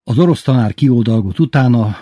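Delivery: ordinary delivery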